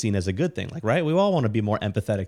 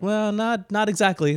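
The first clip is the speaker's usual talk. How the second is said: mild correction; 12 semitones higher than usual. Real, not acted.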